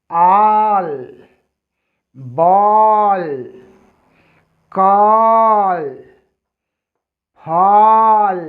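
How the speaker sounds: बॉल, कॉल, फॉल with the vowel stretched long as आ; drawn-out vowel drill. Shown as a demonstration. Teacher models the long आ sound for pupils, stretched. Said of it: "हम इसे पढ़ेंगे आ".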